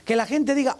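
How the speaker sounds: ordinary delivery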